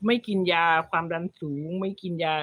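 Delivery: ordinary talk, nothing marked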